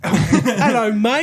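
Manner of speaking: over-the-top fake British accent with a laugh; bad fake British accent. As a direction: squeal it like a cartoon Brit. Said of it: "stereotypical American version of a British accent".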